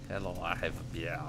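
put-on soft, lightly done French accent; a friend's French accent, done quietly. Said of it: "doing his French accent".

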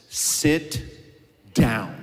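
low, firm command; a calm order from behind; God's command. Acted. God answering the believer, firm command. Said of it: "the voice says".

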